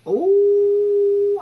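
a sudden high ooh, loud and delighted; high squeal. Real, not acted. Squealing at her mama's sharp mouth.

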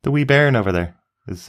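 lilting Scottish accent on wee bairn. Shown as a demonstration. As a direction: say it like a Scot. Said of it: "In Scotland, Scottish".

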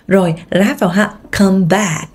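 ordinary delivery

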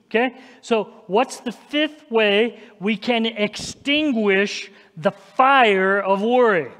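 ordinary delivery

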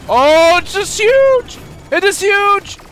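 very high, loud, drawn-out hype; stretched high-pitched shout of excitement; excited yelling. Real, not acted. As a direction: scream it out, thrilled by the big play. Excited yell at big play.

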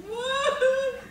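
high, loud incredulous cry; shocked what. Retold or imitated. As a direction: shriek it in disbelief. Disbelief at three more weeks.

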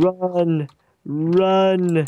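high urgent cry to flee; being chased. Real, not acted. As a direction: yell run in panic. Urging flight from monster.

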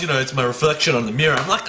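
ordinary talk, nothing marked

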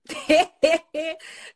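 high, loud helpless laughing; can't stop laughing at the comments; real laughter. Real, not acted. Laughing at viewers' comments on her stream, helpless laughter. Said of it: "I can't stop laughing".

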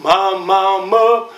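normal talking voice

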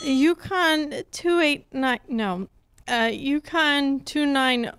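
slow, half-awake phone answer; just woken, answering the phone slowly; sleepy mumble. Acted. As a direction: fumble the number, still drowsy.

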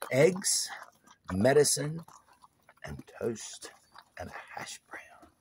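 soft whispered list; hushed whispered list. Real, not acted. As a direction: whisper each item gently. Whispering list while dog eats.

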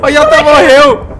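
laughing. Real, not acted. Teammate died, crying laugh.

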